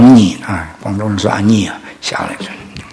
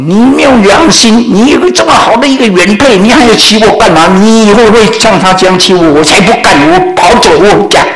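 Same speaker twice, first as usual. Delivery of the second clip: mock angry scolding; 8 semitones higher than usual. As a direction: rail at him like a wronged mistress. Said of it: "大哭大叫， 骂那个她的丈夫". (小三对丈夫夸张地哭骂)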